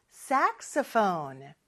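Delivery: "saxophone" starting high on SAX, then falling; slow model of "saxophone", SAX-o-phone; high-low intonation. Shown as a demonstration. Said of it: "이렇게 높낮이가 있어요".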